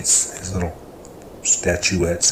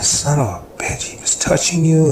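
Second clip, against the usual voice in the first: angry; 9 semitones higher, 6 dB louder than usual. Retold or imitated. Angry outburst at abuse discovered.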